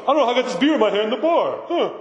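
mock-puzzled excuse; clueless barfly. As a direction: act lightly baffled, like a clueless drinker. Mimics clueless drinker at bar.

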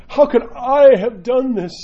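slow, loud, high-pitched disbelief; mock anguish. Sinner shocked at his own fall, mock anguish. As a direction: wail it, hammed-up and drawn out. Acting out wounded ego at failing.

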